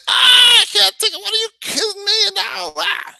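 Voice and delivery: high shrieking panic, hammed-up; mock freak-out. Coach mocking a diner who panics at the dessert offer, shrieking. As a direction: squeal like you're losing it over dessert. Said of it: "You're not gonna freak out and say".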